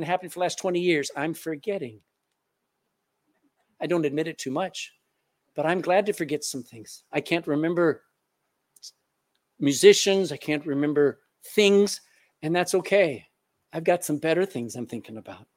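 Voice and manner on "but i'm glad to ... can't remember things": ordinary delivery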